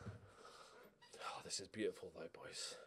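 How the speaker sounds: hushed delight at the league table; awed whisper. Real, not acted. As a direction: breathe it out in wonder. Soft awed aside.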